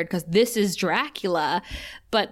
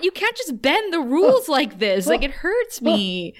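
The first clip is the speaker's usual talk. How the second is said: higher-pitched mock whine of protest; exasperated protest; 5 semitones higher than usual. Podcaster reacts to a rule-bending episode, exasperated protest. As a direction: protest in playful, pained disbelief.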